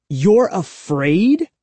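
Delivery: slow, pressing disbelief; demanding. A narrator's reading. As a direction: draw it out, demanding. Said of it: "I demanded".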